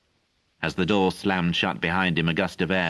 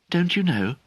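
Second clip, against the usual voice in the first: much higher, startled question; surprised; 12 semitones higher than usual. A narrator's reading. Merlin surprised that Galapas doesn't know. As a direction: ask in real surprise. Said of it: "I said, surprised".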